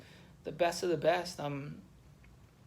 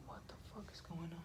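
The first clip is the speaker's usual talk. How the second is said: retold thought in a near-voiceless whisper; inner thought; 2 semitones higher, 15 dB quieter, faster than usual. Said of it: "And I'm, like, thinking".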